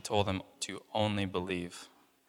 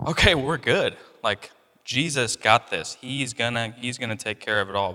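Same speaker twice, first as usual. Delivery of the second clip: relieved confidence; 3 semitones higher, 7 dB louder than usual. Pretend. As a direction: say it like a relieved believer. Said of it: "I'd be like".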